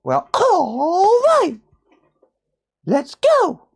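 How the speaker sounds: very high, slow squeaky cartoon mouse voice; Mickey Mouse. Streamer quoting Mickey Mouse to viewers, squeaky high cartoon voice. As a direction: pipe it up like a cheerful cartoon mouse. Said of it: "In the words of Mickey Mouse".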